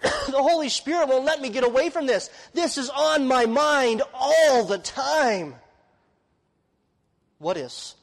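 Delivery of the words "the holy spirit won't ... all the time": high, slow, burdened pleading; preacher voicing Paul's relentless burden; Paul's anguished confession. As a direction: voice Paul pressing out his inner burden. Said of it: "what Paul is saying there".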